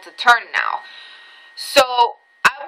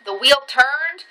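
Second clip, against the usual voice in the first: ordinary talk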